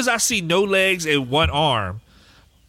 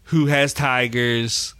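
ordinary delivery

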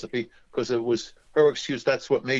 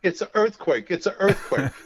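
mock grandmother yelling earthquake; retold yelling; 8 semitones higher than usual. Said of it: "she's yelling".

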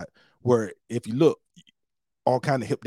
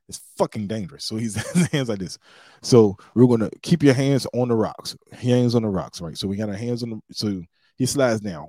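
usual speaking voice

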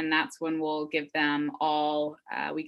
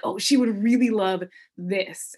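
excited thought; 4 semitones higher, 7 dB louder than usual. Retold or imitated. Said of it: "I'm like".

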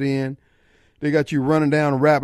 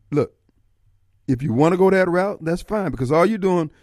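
ordinary delivery

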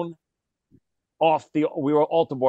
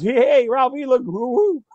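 Norton; 7 semitones higher, 3 dB louder than usual. Retold or imitated. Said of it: "He goes".

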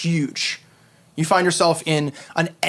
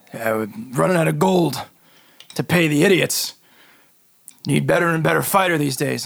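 gruff voice. Acted. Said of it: "this gruff voice come from your mouth".

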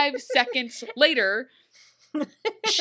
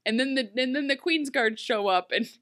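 ordinary talk

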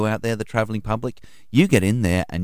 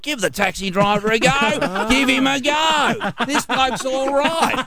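higher, slow, hammed-up drunk bloke; drunk mate voice; slower than usual. Drunk passenger vouching for the cabbie, slow hammed-up drunk. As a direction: ham up a chummy drunk vouching for you. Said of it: "And then he's like".

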